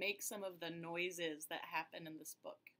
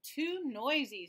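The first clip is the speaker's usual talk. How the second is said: louder fed-up complaint; Peter complaining; 5 semitones higher, 6 dB louder than usual. Acted. Peter complaining about his noisy house, louder. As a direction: groan it out, fed up. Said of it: "said Peter".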